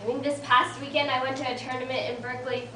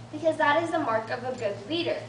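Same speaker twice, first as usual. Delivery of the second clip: ordinary talk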